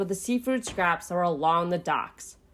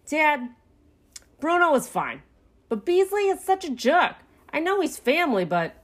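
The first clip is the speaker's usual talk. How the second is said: put-on higher young raccoon voice; complaining son; 8 semitones higher than usual. A narrator's reading. Pinky complains to dad about Beasley, protesting.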